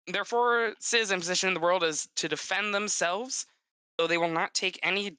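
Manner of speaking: ordinary delivery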